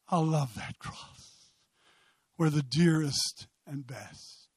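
low, soft, slow spoken hymn; reverent hymn recitation. Real, not acted. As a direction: recite it tenderly with long pauses.

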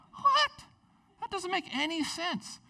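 very high, squeaky incredulity; mock disbelief. Moses told to just say "I am", incredulous. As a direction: balk in a hammed-up high voice.

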